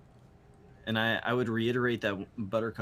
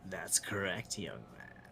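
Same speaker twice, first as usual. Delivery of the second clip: haughty bird creature; 4 semitones lower, 7 dB quieter than usual. Acted. Game master voices the bird.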